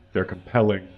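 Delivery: ordinary talk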